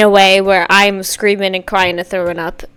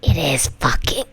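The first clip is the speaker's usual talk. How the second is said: hushed awed whisper; 7 semitones lower than usual. Real, not acted. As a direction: whisper it in awe. Awed reaction.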